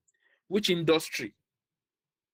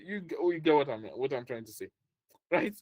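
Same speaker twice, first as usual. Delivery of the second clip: usual speaking voice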